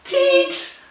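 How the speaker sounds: comic high yelp; high squeak. Acted. Squeaked title repeat.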